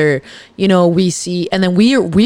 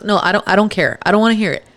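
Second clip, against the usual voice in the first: usual speaking voice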